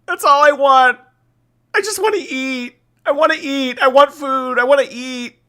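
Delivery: high, whiny mock sob, like a denied toddler; hammed-up wail of a toddler who wants to eat; mock crying whine. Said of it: "And she's crying".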